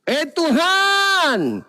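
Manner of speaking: high, long-drawn call to God; hammed-up protesting cry; mock shouting woman. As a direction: wail up to heaven, stretching the words. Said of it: "perempuan juga teriak".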